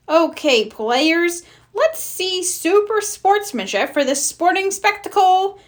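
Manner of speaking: high, grand announcement; hammy referee. Acted. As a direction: play the showy referee.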